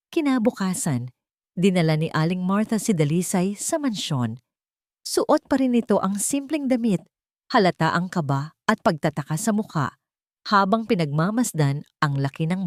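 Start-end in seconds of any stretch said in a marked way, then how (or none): none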